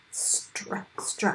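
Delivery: "Str" whispered softly; whispered blend. Shown as a demonstration. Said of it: "begin with S T R".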